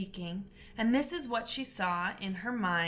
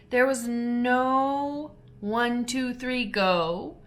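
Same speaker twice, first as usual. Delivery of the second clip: slow dodo count; 3 semitones higher, 4 dB louder, much slower than usual. A narrator's reading. Counting off the race start.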